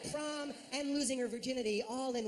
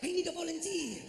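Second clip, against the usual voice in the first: a higher, fading suave pitchman voice; Enrique impression; 5 semitones higher than usual. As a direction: slide into the smooth beer-ad man. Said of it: "That is not how he sounds".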